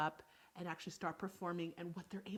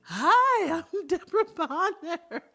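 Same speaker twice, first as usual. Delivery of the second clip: high, loud, silly greeting with a laugh; goofy loud introduction; 10 semitones higher, 17 dB louder, slower than usual. Pretend. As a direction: ham it up to crack your partner up. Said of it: "But I can't smile".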